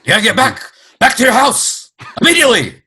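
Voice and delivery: high, loud frantic inventor; Doc Brown. Acted. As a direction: shout urgent orders like a panicked mad scientist. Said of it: "What if we are four Doc Browns".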